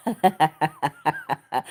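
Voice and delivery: low, soft staccato chuckle; a run of quiet, low chuckles; amused laugh. Real, not acted. Amused by a viewer's question.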